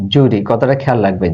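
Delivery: ordinary talk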